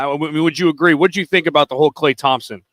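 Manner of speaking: ordinary delivery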